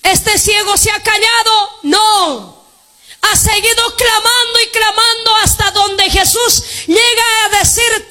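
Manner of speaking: high, ringing pulpit cry; preaching at full force. Acted. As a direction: preach it out to the congregation. (predicando a todo pulmón)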